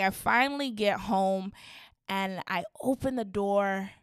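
usual speaking voice

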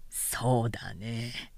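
slow, hushed musing; pondering. A narrator's reading. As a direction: let it hang, thinking it over. Kai pondering.

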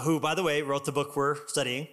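ordinary talk

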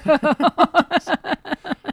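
big burst of laughter; loud high laugh. Real, not acted. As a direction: crack up loudly. Laughs about wedding place.

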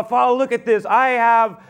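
high, loud, aggrieved protest; resentful older son protesting to his father; indignant son. Acted. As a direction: pour out years of resentment. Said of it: "older son says to his father".

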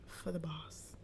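very soft, breathy whisper. Real, not acted. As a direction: barely whisper it. Hushed recording setup talk.

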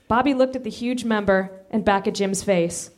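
normal talking voice

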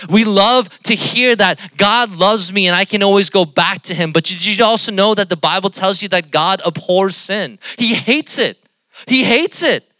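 loud, high, emphatic preacher; full-force preaching. Acted. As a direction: drive it home to the congregation.

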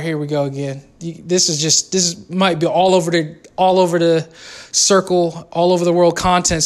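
ordinary talk, nothing marked

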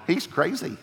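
mock family gossip, half-laughing; a lightly hammed-up gossiping relative; playful mock gossip about a crazy son; voicing gossiping family. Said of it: "let me bring that up modern day".

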